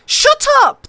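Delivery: spoiled prince screaming back; yelled back. A narrator's reading. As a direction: shout her down. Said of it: "the prince yelled back".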